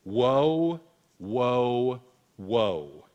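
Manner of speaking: loud ringing woe, fading on the last; a preacher voicing the eagle's loud cry of woe. A narrator's reading. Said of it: "an eagle crying with a loud voice".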